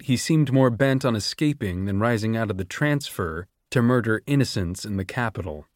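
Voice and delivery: ordinary talk, nothing marked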